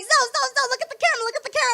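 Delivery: high coaxing mom voice; mom filming. Retold or imitated. Mom taping her child, high and coaxing. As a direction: call to the kid behind the camera. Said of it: "she'd be like".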